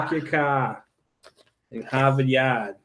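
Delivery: r-dropping Boston accent; park your car in Harvard Yard, Boston accent. Shown as a demonstration. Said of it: "New Englanders drop R's".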